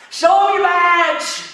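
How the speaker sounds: long, high, drawn-out holler; shouting. Acted. Rancher hollers over the fence to the fleeing agent, mocking shout. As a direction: call it out slowly at full volume.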